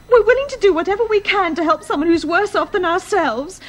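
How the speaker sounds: normal talking voice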